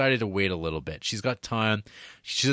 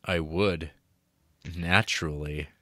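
lower, deadpan Italian man's reply; low Giovanni; 6 semitones lower than usual. Retold or imitated. Giovanni answering Mary, low and certain. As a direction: drop the voice low and settle it flat.

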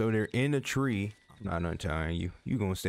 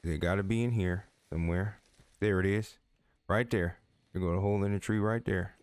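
usual speaking voice

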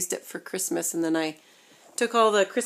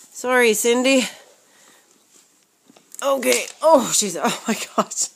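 normal talking voice

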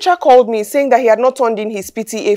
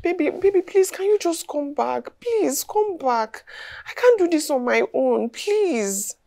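slow, softer, drawn-out begging; pleading; 7 dB quieter, slower than usual. Acted. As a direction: beg your husband to come back. Wife begs husband to come home.

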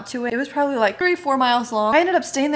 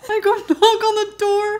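high, louder, laughing through tears; laugh-crying; 10 semitones higher, 5 dB louder than usual. Real, not acted. Retelling the cat story, she repeats it laugh-crying. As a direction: cracks up mid retelling.

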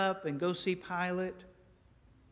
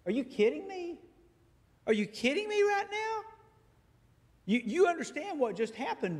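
incredulous Pilate; 8 semitones higher, 4 dB louder than usual. Acted. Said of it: "maybe this is how it went".

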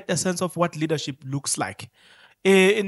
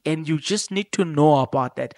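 usual speaking voice

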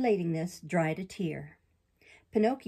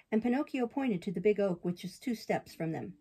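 usual speaking voice

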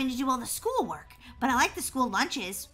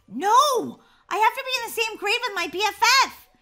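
loud, high-pitched outcry; distraught protest; 6 semitones higher, 7 dB louder than usual. Acted. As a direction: protest at the top of your voice. Learns she may fail the year.

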